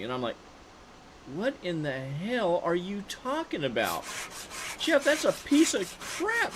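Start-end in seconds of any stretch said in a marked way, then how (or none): 1.3-6.5 scoffing disbelief. Retold or imitated. Scoffing at a rusty car.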